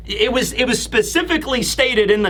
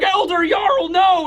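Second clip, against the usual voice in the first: high-pitched panicked protest, hammed up; retold alarmed cry; 9 semitones higher than usual. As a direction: cry out in mock horror for an elder. Retelling alarmed onlookers' cry.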